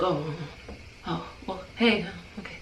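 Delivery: very soft, drowsy stammer; imitated groggy mumble. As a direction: mumble it as if nudged out of a doze. Man jolted from dozing on shoulder.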